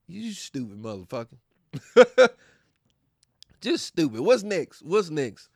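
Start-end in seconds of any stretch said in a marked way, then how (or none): none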